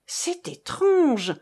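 slow, high-pitched, drawn-out «étrange»; repeated wonderingly. A narrator's reading. Marcof examining the face by torchlight, wondering aloud. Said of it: "répéta-t-il".

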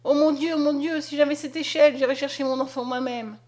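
high, urgent rush of words; desperate search for a ladder; frantic pleading. A narrator's reading. Mother pleading frantically for a ladder. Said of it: "s'écria Julienne".

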